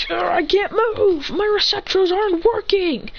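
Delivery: high, slow, strained complaint; straining frozen. Acted. Ida frozen in place, straining and complaining. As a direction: struggle against being frozen.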